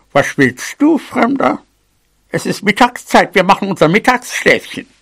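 put-on granny voice, annoyed; grumpy mouse granny. A narrator's reading. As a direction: scold a stranger for disturbing the nap. Grandmother mouse rebuffs a stranger.

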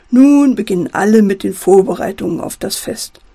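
ordinary delivery

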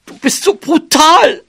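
high-pitched, upset mouse outburst; scolding. A narrator's reading. Mouse flung away by elephant, scolding in outrage. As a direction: scold him in a high squeaky voice. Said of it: "schimpfte sie".

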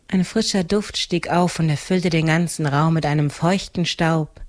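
plain reading voice

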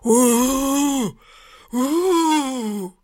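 high, drawn-out ghostly "uuuh"; eerie ghost wail. A narrator's reading. Brother playing ghost at his sister's door, eerie wail. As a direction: hoot long and high like a spooky ghost. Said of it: "rief schauerlich".

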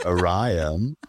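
ORION said as oh-RYE-un; English pronunciation. Shown as a demonstration. Explaining how they say it over there, "Orion" as oh-RYE-un. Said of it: "also die da sagen".

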